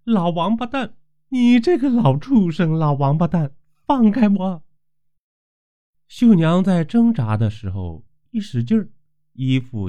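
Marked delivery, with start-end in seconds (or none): 0.0-4.6 much higher, furious shouted insults; struggling young woman swearing at the landlord, high and loud; cursing loudly. Acted. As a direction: scream curses at him while struggling free. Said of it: "一边挣扎一边大骂".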